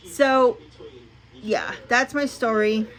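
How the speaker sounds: ordinary talk, nothing marked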